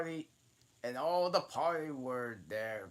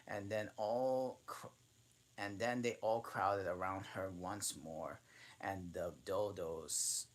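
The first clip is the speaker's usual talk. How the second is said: usual speaking voice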